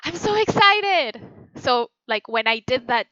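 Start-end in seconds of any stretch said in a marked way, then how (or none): 0.0-1.2 high bright thrilled line; excited. Shown as a demonstration. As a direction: burst with excitement. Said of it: "the one that I did was".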